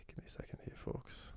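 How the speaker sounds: whispered aside to the viewers. Real, not acted. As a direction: drop to a whisper while busy. Asks viewers to wait.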